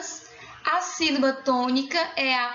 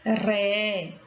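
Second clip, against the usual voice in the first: "Ré" drawn out on its own, lower; stressed syllable alone; 4 semitones lower than usual. Shown as a demonstration. Teacher answering which syllable is stressed, "Ré" held firmly. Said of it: "Qual é a sílaba tônica?".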